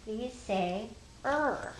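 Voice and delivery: slow deliberate teacher voice; slow and clear; slow explaining. Shown as a demonstration. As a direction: spell it out slowly for kids. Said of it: "They would say".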